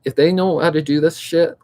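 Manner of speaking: ordinary talk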